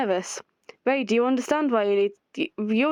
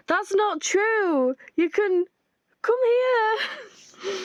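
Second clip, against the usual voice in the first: much higher, slow and sugary; sing-song invitation; high sweet pleading; 10 semitones higher, much slower than usual. Acted. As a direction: draw them in tenderly.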